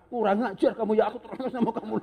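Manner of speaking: high mock scolding with a laugh creeping in; mock angry. Crazy husband angry and aroused at once, comic mock scolding. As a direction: scold hotly but comically, on the edge of laughing. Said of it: "bisa terangsang sambil marah".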